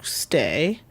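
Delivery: ordinary talk, nothing marked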